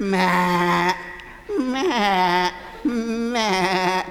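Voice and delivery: drawn-out imitated bleating; goat bleat. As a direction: bleat like a little billy goat.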